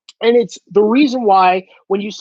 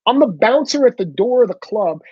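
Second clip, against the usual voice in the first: ordinary talk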